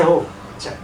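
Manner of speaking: ordinary talk, nothing marked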